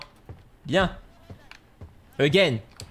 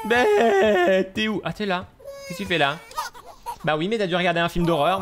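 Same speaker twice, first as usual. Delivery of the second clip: usual speaking voice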